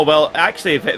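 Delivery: ordinary talk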